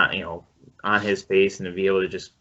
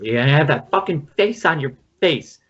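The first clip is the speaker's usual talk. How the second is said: mock angry yell, higher and louder; retold angry outburst; 7 semitones higher, 5 dB louder than usual. Film mother snapping at her son, a mock angry yell. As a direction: snap it like a furious mother. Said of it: "and she goes".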